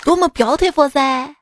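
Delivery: put-on comic street vendor in Xi'an accent; Xi'an-accented Mandarin hawker. Vendor selling to foreigners near the Terracotta Army, Xi'an accent. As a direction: hawk goods in Xi'an dialect mixed with English. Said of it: "就是西安普通话夹杂着".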